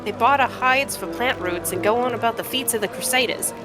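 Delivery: feisty trader putting the stranger in their place; brash cave trader. Acted. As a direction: haggle like a proud junk dealer.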